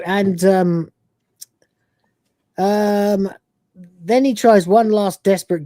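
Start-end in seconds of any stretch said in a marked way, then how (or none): none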